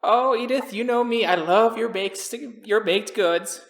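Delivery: high-voiced, gushing old lady; little old lady. Little old lady praising Edith's baked goods, gushing. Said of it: "a little old lady".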